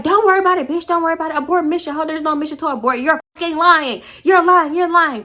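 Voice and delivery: high-pitched mimicked argument; insistent high put-on quarrel voice; mock accusing. Acted quarrel, Pick Me accuses.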